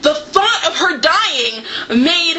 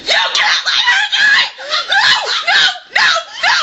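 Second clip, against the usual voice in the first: laughing shout. Retold or imitated. Begging the author to spare her, laughing shout. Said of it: "I'm like".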